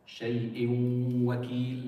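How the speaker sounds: "Shay'in wakil" with a very long nasal w; emphasis on second letter. Shown as a demonstration. Teacher showing students the emphasis, long humming w in "Shay'in wakil". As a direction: melt the n of "Shay'in wakil" into a slow, level held waw. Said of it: "making the emphasis on the second letter".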